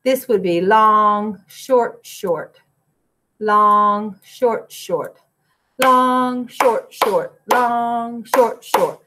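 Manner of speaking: "long" held, "short, short" quick and clipped; long short rhythm. Shown as a demonstration. Teacher speaking note lengths to students. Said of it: "compared the quarter note to the eighth note".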